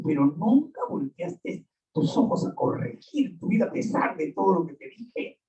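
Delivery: ordinary delivery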